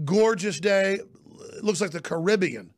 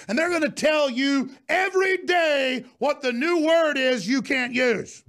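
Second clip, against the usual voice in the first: angry shouting; 7 semitones higher than usual. Real, not acted. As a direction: yell it as a warning. Rant peaks in shouting.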